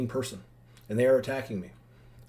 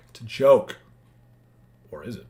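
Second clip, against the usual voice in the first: teasing; 2 semitones lower, 8 dB louder than usual. Real, not acted.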